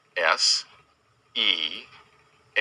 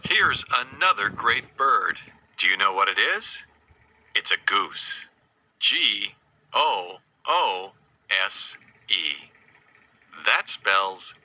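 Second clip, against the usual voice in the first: ordinary talk, nothing marked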